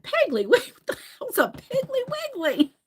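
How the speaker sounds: high, laughing bafflement; baffled and tickled by a silly name; incredulous question through laughter; amused disbelief. Retold or imitated. Acting out amused disbelief at store name.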